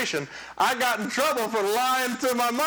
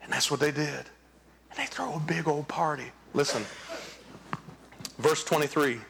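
low, hushed whisper with a laugh; whispered aside; 8 semitones lower, 5 dB quieter than usual. Real, not acted. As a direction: share it like a secret, chuckling. Aside about the party.

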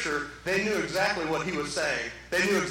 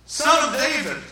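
higher, louder acclaiming call; crowd crying out; 6 semitones higher, 6 dB louder than usual. Acted. Preacher acts the crowd hailing Messiah, calling out. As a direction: hail him from the crowd. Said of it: "people all around him yelling out".

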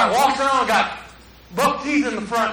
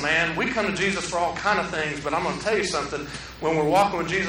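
normal talking voice

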